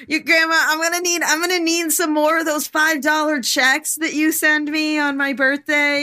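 put-on high squeaky little kid asking for money; high childish plea to grandma. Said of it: "I don't know what, what voice that is".

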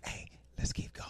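hushed, whispered mock call to go; retold urging. Mutiny cage urging each other to climb on, whispered. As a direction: whisper it, voicing the cage crew. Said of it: "mutiny cage is like".

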